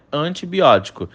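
ordinary talk, nothing marked

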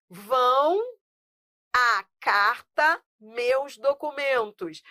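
"à carta meus documentos" read slowly; slow example read. Shown as a demonstration. Teacher reading the exercise to students, slowly. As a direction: read the sample sentence slowly.

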